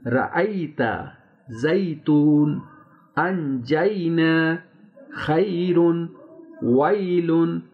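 slow recited list, each "ai" glide drawn out in زیتون، انجینا، ویلن; Arabic pronunciation. Shown as a demonstration. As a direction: hold the ya in each word, from رأیت to ویلن. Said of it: "عربی کا تلفظ پڑھنا ہے". (رأیت سے ویلن تک عربی تلفظ، یا واضح)

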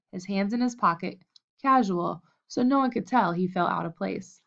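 usual speaking voice

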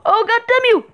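high-pitched startled swearing; exasperated curse. Real, not acted. Player cursing an enemy in the game, exasperated. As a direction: curse it in a sudden high outburst.

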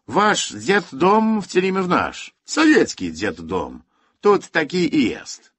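put-on loud, slow, smug Russian accent; a gloating Soviet officer with a Russian accent. A narrator's reading. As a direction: savour each Russian-accented word of the threat. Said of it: "N K W dzista uśmiechnął się".

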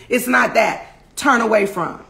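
normal talking voice

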